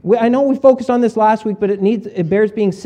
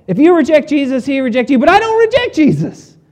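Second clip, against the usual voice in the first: high, loud, brushing off the warning; mocking skeptic; 7 semitones higher, 4 dB louder than usual. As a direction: echo it back flippantly. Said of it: "you maybe are thinking".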